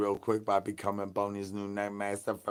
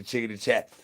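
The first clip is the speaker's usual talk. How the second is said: usual speaking voice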